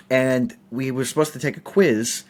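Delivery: ordinary talk, nothing marked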